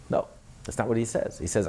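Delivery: normal talking voice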